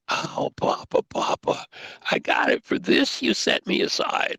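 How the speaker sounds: breathless, excited Papa, Papa; a rush of joyful discovery; overjoyed son calling his father; enthusiastic. Retold or imitated. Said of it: "with enthusiasm and excitement".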